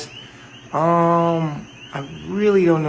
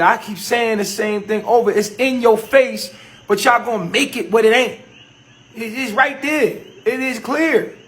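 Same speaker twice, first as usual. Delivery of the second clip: raised frustrated voice; 5 semitones higher, 6 dB louder than usual. Real, not acted. Frustrated host raising his voice.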